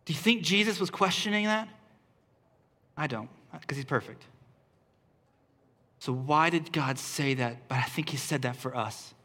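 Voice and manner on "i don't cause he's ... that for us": hushed aside. Real, not acted. Quiet aside to congregation.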